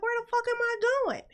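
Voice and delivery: high-pitched, quick, baffled question; mock bewilderment. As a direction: ask it fast and high, totally lost. Retells being urged on, bewildered.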